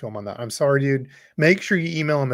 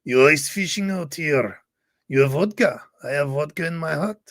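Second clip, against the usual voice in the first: put-on Russian accent. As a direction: play a scary Russian ice fisherman in a Russian accent. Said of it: "I'm gonna use your Russian accent".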